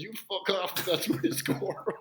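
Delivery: high-pitched, laughing retort; mock indignation. Teammate's unspoken thought about who scored, mock indignant. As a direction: protest high, breaking into a laugh.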